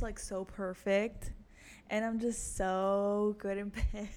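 slow, smug mock boast; sarcastic bragging. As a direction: drag it out like a fake diva. Mock bragging about herself.

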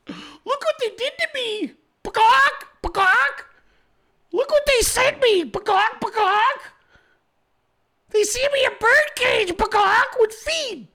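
very high squawky bird voice; parrot voice. Pretend. Mocking Haley as a bird.

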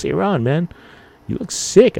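high, concerned friend voice; friend's voice. Retold or imitated. Friend spotting dizzy Ron on the street, high and worried. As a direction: sound like a worried buddy.

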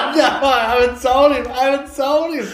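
high, loud laughing outburst; laughing protest. Real, not acted. Reviewer to co-host who won't watch the film, laughing protest. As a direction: cry out through real laughter.

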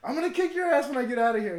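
high, loud mock-raging yell; hammed-up angry shouting; imitated furious threat. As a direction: holler it like someone stuck and raging. Voicing trapped roommate's threat.

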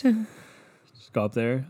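ordinary talk

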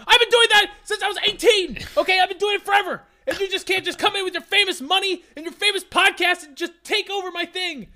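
high, loud indignant rant; worked-up rant. Real, not acted. Ranting about stolen podcast idea.

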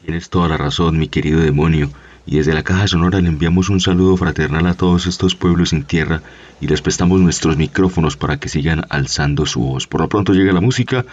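loud, projected on-air sign-off; radio announcer. Acted. Host sends greetings to the peoples, then introduces the music, announcer voice.

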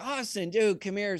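high, lightly voiced rock star welcome; friendly greeting. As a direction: call him over with easy cheer. Frank greets him warmly.

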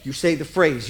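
ordinary talk, nothing marked

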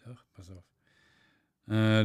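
normal talking voice

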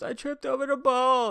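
put-on girl telling counselors she tripped, high whiny; hurt girl. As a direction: play the injured kid, plaintive. Hurt girl voiced in story.

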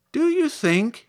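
very high scarecrow question; hopeful scarecrow. Acted. Scarecrow asks girl about getting brains, hopeful. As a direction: ask hopefully, pitched well up.

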